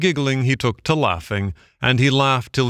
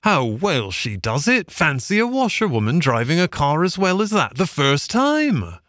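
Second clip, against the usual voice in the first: admiring gentlemen; 6 semitones higher than usual. A narrator's reading. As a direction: marvel at her like impressed gentlemen. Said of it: "Toad heard them saying".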